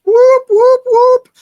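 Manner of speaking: high siren whoops, then a slow PA announcement; imitated ship alarm. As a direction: mimic the ship's alarm and loudspeaker call. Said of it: "they were like".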